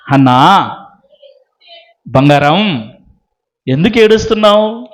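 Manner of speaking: low, gentle coaxing to a crying wife; tender husband. Acted. Husband Elkanah soothing his weeping wife, low and tender. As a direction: soften right down and soothe her.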